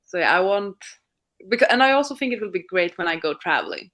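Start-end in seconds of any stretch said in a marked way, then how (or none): none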